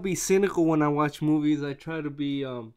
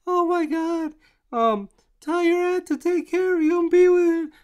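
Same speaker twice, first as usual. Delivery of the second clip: much higher, louder mock mom voice; frantic mom; 7 dB louder than usual. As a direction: squeal it like a panicked mother. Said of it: "and the mom's like".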